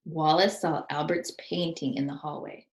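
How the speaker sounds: ordinary delivery, nothing marked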